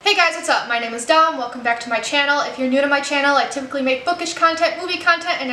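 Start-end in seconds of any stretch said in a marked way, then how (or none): none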